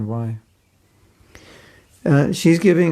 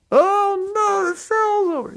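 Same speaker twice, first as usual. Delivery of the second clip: very high, whiny mock dismay. Mocking a spoiled shopper wailing that the sale ended, very high. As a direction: wail over the sale like it's tragedy.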